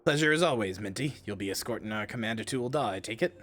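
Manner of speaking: NPC voice. Acted. Streamer voicing a noble NPC for chat, low and soft. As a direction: read it as a polite noble greeting an ally.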